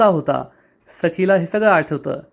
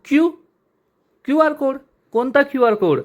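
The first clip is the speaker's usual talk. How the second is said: high, baffled double take; puzzled surprise; 8 semitones higher than usual. A narrator's reading. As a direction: pitch jumps up in disbelief. Kanha puzzled by QR request. (गोंधळलेला, उंच आवाजात आश्चर्य)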